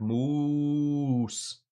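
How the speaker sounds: "Moose" drawn out with a lifted, then falling Irish tune; long, lilting Irish "Moose"; Irish accent test. Shown as a demonstration. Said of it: "can you say moose in an Irish accent".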